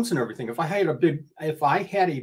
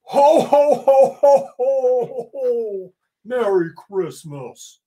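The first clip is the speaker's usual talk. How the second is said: booming jolly ho ho ho; belly-laugh it out like Santa; Santa impression; 8 semitones higher, 7 dB louder, much slower than usual. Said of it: "That's my best Santa impression that I can do".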